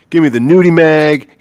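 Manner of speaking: a hint of a cartoon character's demand; Homer. Retelling a Simpsons shopping scene, light impression. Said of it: "what did Homer buy?".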